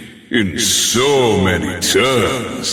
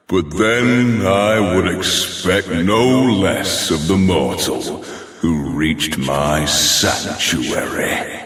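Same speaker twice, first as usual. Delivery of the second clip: normal talking voice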